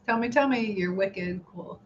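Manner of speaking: ordinary talk, nothing marked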